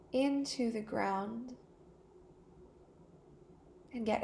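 calm meditation voice with long pauses; guided meditation. Real, not acted. Teacher easing listeners into a body scan, calm guided meditation. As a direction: guide them unhurriedly into stillness, pausing between phrases.

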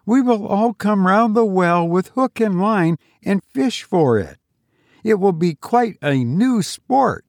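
put-on high girl's voice, bravely making light; a touch of tearful cheer, pitched high; half laughing, half crying. A narrator's reading. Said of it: "half laughing, half crying".

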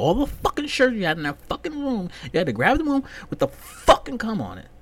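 high, loud, exasperated squawk at the shirt; mock outraged yell. Ranting at the roommate over the dirty shirt, high outraged yell. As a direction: yell in disbelief at whoever left it. Angry rant at shirt's owner.

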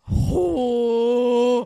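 long, loud drawn-out "oh" of shock; hammed-up wail of dismay. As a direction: wail it out in mock horror. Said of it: "we're like".

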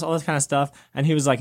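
ordinary talk

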